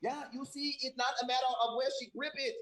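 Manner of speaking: high, laughing mock-professor voice; silly high voice. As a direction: play a pompous expert, giggling. Mock posh scientist voice, laughing.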